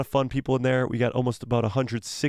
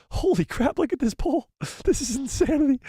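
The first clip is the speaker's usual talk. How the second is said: much higher, thrilled and laughing; shocked delight at the numbers; excited; 13 semitones higher than usual. Real, not acted. Huge poll result surprises streamer.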